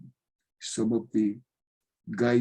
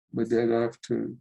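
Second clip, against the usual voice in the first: ordinary delivery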